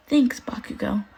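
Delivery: slow, soft, shaky thanks; wobbly smile. A narrator's reading. Mina thanking Bakugo through a wobbly smile, touched. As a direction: thank him through tears. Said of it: "with a wobbly smile".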